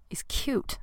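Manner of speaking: a breathy secret whisper; whispered aside. Real, not acted. Whispering to listeners that he's cute. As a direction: whisper it like a confession. Gushing aside about the guy.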